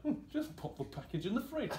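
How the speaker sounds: high, sugary, laughing ad voice; mock a cutesy smoothie label; twee brand voice. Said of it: "their tone of voice is very irritating".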